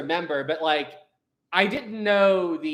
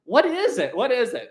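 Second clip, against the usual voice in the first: higher, eager repeated asking; curious kid; 8 semitones higher than usual. Retold or imitated. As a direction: pester like an impatient kid. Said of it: "I'm like".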